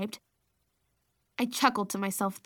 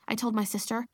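ordinary delivery

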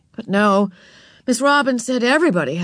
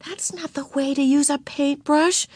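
higher-pitched bossy correction; scolding older sister; 7 semitones higher than usual. A narrator's reading. Older sister corrects Ramona's painting, bossy and higher. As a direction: tell off a little sister.

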